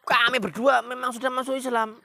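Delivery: high, loud defiant declaration; too harsh. Shown as a demonstration. Wrong take of sister answering Umar, too harsh. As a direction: blurt it out too hard. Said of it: "Bukan. Ini bukan, bukan".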